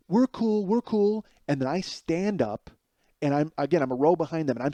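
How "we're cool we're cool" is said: higher, placating nervous voice; calm things down fast, a bit scared; nervous backing off. Retold or imitated. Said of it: "his friend is like".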